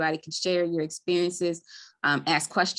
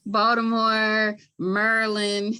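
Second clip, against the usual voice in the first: slow, local Baltimore pronunciation; demonstrating a Baltimore accent on "Maryland"; 4 semitones higher, 3 dB louder, slower than usual. Shown as a demonstration. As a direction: say Maryland the Baltimore way, squeezed down to "Merlin". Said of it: "this is how we say it".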